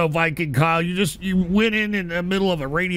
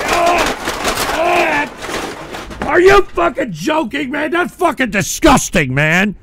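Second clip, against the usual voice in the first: disgusted angry shouting; 10 semitones higher than usual. Real, not acted. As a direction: scream it out in disgust. Disgusted by the clip he just watched.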